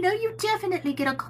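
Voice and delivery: normal talking voice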